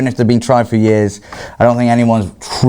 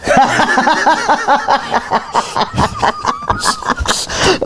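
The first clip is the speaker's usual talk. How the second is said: high shrieking burst of laughter; real uncontrollable laughing fit; big laugh. Real, not acted. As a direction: crack up helplessly, squealing. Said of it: "I laughed".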